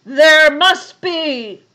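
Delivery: loud, high, slow bear roar; growly bear. A narrator's reading. Bear insisting there is room, roaring. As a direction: roar it slowly with full force. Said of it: "said the bear".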